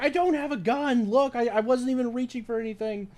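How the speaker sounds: high, frightened imitated plea; bewildered pleading. Shot man to the cop, high frightened pleading. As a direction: play the shot man's shocked disbelief. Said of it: "And he's like".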